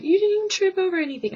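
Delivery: high, fast disbelieving squeal; incredulous. Retold or imitated. Teasing the guy who tripped, high fast incredulous. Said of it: "I'm just like".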